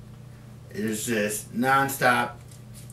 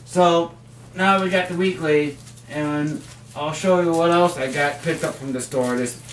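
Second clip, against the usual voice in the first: usual speaking voice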